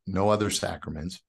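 normal talking voice

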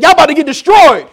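high, loud cry of alarm; acted doom-warning yell; urgent warning. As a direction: shout a warning to the family. Lot urgently warning his laughing family.

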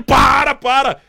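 loud, high cry to stop; shouting stop. Retold or imitated. Man on a bike yelling at the driver to stop, loud cry. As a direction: yell at the car to stop. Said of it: "e ele".

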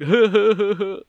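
high, loud wheezy comic laugh; Seth Rogen laugh. Shown as a demonstration. Co-host imitating a celebrity laugh, high and loud. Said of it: "the Seth Rogen laugh".

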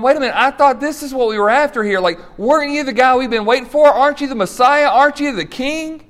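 let-down followers demanding their king; frustrated crowd. Retold or imitated. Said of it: "They were going".

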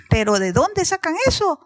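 loud, high-pitched protest; incredulous lion king. Acted. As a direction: scold the animals for their nonsense. Lion scolds the panicked animals. (rey león incrédulo, fuerte y agudo)

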